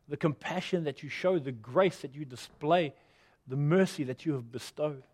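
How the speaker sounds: soft, hushed pastor's prayer over music; gentle closing prayer. Acted. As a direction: pray quietly for the congregation.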